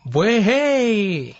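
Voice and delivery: mocking high whoop, eyes rolling. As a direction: cheer her on sarcastically. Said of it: "she rolls her eyes and goes".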